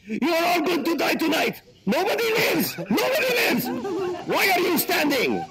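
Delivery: very high, hammed-up threatening voice; mock hostage-taker threat. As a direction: threaten the whole plane in a silly high put-on voice. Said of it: "dangerous hostage situation".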